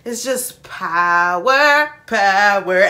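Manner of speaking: slow, punched "power"; loud stretched "power, power"; emphatic hype. Real, not acted. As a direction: lean hard into "power". Hyping the group's power.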